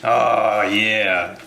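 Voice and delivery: imitating a TV chef relishing his meat; Emeril Lagasse. Cook mimicking a TV chef's little sound effects, savoring impression. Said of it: "he would do little sound effects like".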